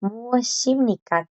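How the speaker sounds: muashimnika drawn out slowly; slow Korean demo. Shown as a demonstration. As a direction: stretch muashimnika so the sound carries. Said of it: "como cuando hicimos".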